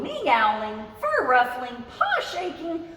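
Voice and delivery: usual speaking voice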